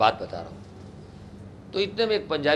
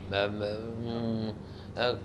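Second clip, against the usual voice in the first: hesitant mumble; 4 semitones lower, 8 dB quieter, much slower than usual. Retold or imitated. Candidate hesitating for words.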